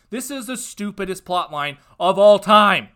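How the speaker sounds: loud, disgusted verdict; fed-up fan yelling at the screen; exasperated outburst. Real, not acted. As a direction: spit it out in disbelief. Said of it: "I apologize for that outburst".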